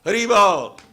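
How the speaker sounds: high, loud bright call of Hari Bol; cheerful greeting. Retold or imitated. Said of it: "just go".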